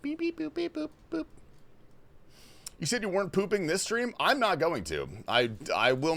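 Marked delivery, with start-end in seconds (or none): 0.0-1.3 high robotic beep boop; high beeping noise. Retold or imitated. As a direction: chirp it like a machine.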